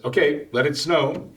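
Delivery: a hint of an eager kid calling the snow; kid Calvin voice. Boy on the back porch asking for snow, lightly voiced eager kid. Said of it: "he says".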